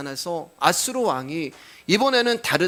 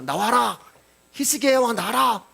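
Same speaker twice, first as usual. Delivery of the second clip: calling out; 5 semitones higher than usual. Acted. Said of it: "왕을 부릅니다".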